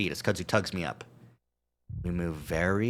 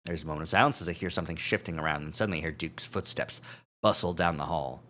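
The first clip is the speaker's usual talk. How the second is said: ordinary talk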